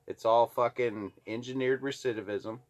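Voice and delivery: ordinary delivery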